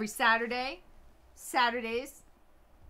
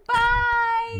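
squeaky high creepy bye; a high, loud creepy ghost bye; 12 dB louder than usual. Pretend. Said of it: "creepy wave".

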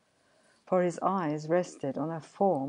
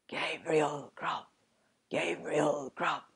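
wild spectral chorus; 3 dB quieter than usual. A narrator's reading. Goblin chorus taunts the sexton, eerie chanting. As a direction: chant the name slowly like unseen spirits. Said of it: "The invisible chorus replied".

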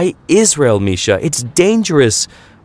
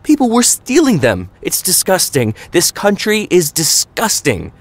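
climbing higher and higher, fed-up tirade; raging rant; 9 semitones higher than usual. A narrator's reading. Mama venting to Papa at dinner prep, high climbing angry rant. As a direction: rant like every word is a knife whack. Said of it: "Mama raged at Papa".

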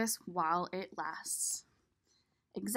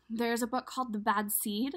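ordinary delivery, nothing marked

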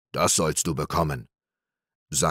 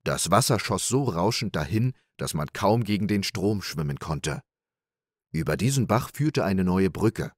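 ordinary delivery

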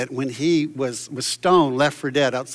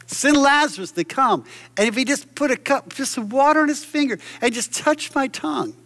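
rich man calling; 10 semitones higher than usual. Acted. Rich man calling across the chasm.

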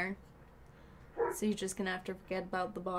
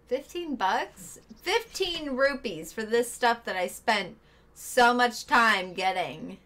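higher, louder indignant protest; outraged disbelief; 3 semitones higher, 5 dB louder, slower than usual. Real, not acted. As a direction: scoff at the lowball offer. Low offer for his loot.

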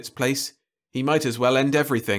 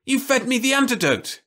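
much higher, louder accusing cry; anguished cry; 13 semitones higher, 3 dB louder than usual. A narrator's reading. Jim crying out at Louise who drugged him. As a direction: cry out in betrayed fury. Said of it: "he cried".

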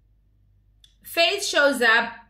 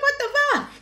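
high squeaky shocked laugh-voice; mock disbelief. Vlogger acting her own shock at Faith's bodyguard. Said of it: "I'm like".

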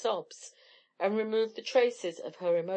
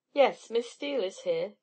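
ordinary delivery, nothing marked

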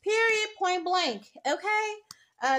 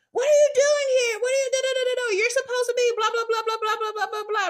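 teacher blowing up; 6 semitones higher, faster than usual. Retold or imitated. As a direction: play the startled teacher snapping at the boy. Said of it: "She blew up at him".

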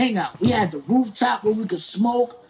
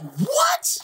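stunned what. Retold or imitated. Reacting to label choosing De La Soul, stunned disbelief.

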